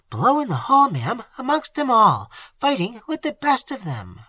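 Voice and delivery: high, excited servant voice; breathless maid reporting. A narrator's reading. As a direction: blurt out news of the brawl.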